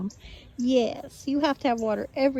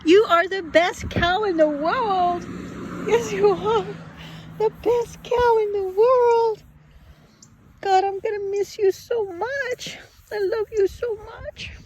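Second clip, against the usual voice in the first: tearful goodbye; 8 semitones higher, 3 dB louder than usual. Real, not acted. Emotional farewell to cow.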